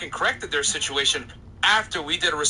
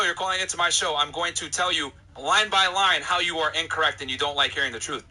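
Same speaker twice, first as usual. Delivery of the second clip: ordinary talk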